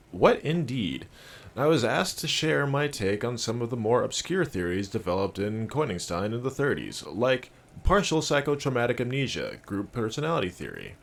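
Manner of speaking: [name]. Acted. Trent sharing obscure theories, in presenter voice. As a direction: lecture like a training-video host. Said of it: "I can do that voice".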